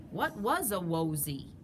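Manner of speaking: usual speaking voice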